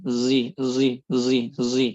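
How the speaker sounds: zi said with a sad blended toward z; isymam zi sound. Shown as a demonstration. Teacher drilling the sad-zai blend: zi, zi. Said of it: "jawabannya az-zi".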